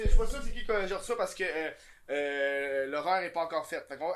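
ordinary talk, nothing marked

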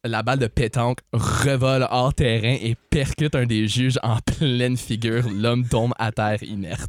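calm narrator voice setting the scene; sketch narration. Acted. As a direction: tell it like a storyteller.

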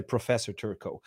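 put-on Professor Turco in an English accent. English colleagues addressing him, English accent. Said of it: "en Angleterre".

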